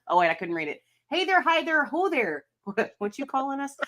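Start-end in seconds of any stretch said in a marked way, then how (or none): 1.1-3.8 put-on reading a chat comment in a light Minnesota accent; a hint of Minnesota on the greeting; lightly Minnesotan hey there. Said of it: "you read that in a Minnesota accent".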